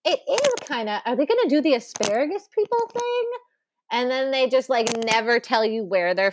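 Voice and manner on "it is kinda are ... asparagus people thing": high-pitched, wondering question; retold puzzled reaction. Viewer recalling guesses during the film, retold puzzlement. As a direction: imitate your puzzled self, pitch climbing. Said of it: "And I was like".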